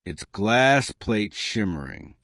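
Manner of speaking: ordinary delivery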